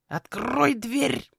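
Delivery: high, drawn-out croak with rolled r; hoarse raven. Acted. Squeezed raven urging the girl to open, hoarse croak. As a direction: rasp it out as if squeezed. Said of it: "прохрипел Ротгар".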